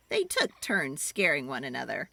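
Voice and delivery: usual speaking voice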